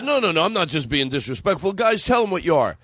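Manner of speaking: high, pleading stage-comic protest; Rodney. Retold or imitated. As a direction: insist innocently, then cue the band. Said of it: "He goes".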